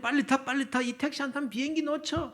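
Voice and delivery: high-pitched acted yell to hurry; urgent calling out. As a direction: play colleagues calling a friend into the taxi. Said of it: "막 소리를 지릅니다". (다급하게 높은 소리로 재촉하는 외침)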